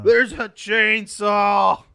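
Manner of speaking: loud, slow alarmed shout; startled shout. Real, not acted. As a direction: yell out the danger. Sudden call-out in game.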